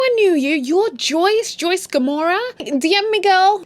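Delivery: high, loud starstruck fangirl; gushing fan. Acted. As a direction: squeal it like meeting a celebrity. Fan gushing over famous Joyce.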